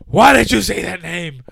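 slow, high, loud movie-hero demand; hammed-up anguished demand; mock dramatic yell. Acting out the film's line.

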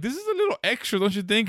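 high, teasing comeback; retold snark to the vet, pitched high; sassy retort. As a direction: needle the vet with a sarcastic question. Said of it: "I'm like, I'm like".

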